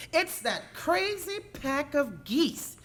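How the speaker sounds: a miffed puppy grumbling; annoyed Sassy voice. Acted. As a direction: play Sassy, fed up with the geese.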